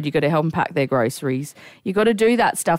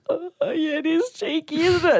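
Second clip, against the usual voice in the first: high, tight, tearful agreement; trying not to cry; 11 semitones higher than usual. Retold or imitated. Helper humouring elderly man, holding back tears. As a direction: agree brightly while close to tears. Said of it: "trying not to cry in his face".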